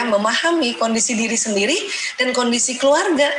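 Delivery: normal talking voice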